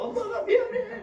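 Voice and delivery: high, loud tearful wail; mock crying. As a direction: imitate a mother wailing her apology. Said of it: "울면서".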